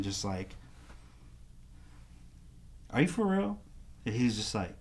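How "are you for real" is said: high-pitched disbelieving question; pitched-up stunned are you for real; amazed question. Retold or imitated. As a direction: ask it like it's too good to be true. Asking friend again in disbelief.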